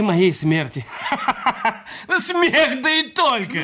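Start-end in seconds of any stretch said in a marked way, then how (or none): 1.1-3.6 high, laughing scoff at her demand; mocking laugh. Husband laughs off his wife's demand, mocking laughter. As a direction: laugh it off as absurd.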